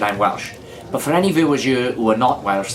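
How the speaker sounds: ordinary delivery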